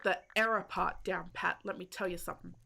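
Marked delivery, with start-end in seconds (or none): none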